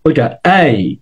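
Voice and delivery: normal talking voice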